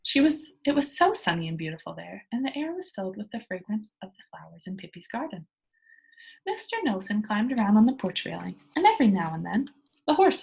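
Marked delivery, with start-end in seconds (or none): none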